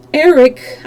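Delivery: cried name; cry out. Acted. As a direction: call out his name. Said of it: "I cried".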